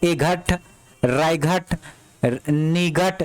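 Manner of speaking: ए घट, राय घट, निघट with the silent GH sounded as घ; wrongly voiced GH. Shown as a demonstration. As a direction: deliberately misread with a घ: ए घट, राय घट, निघट. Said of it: "कहीं पढ़ा". (घट में घ का गलत उच्चारण करके दिखाएं)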